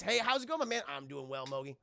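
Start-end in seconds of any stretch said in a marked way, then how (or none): none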